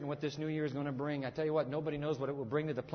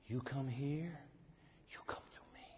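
softer, fading into a whisper; hushed threat; 5 semitones lower, 12 dB quieter than usual. Acted. Preacher repeating the prince's threat to the congregation, hushed to a whisper. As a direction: breathe the threat almost unvoiced.

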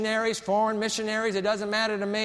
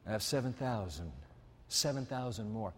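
much softer, lower, almost confiding; hushed divine voice; 8 semitones lower, 11 dB quieter than usual. Acted. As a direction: let it sink low and quiet. God's voice, very quiet repeat.

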